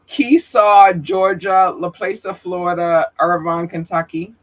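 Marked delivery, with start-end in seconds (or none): none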